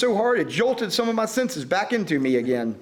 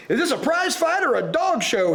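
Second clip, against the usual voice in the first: howl; 8 semitones higher than usual. A narrator's reading. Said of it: "he howled".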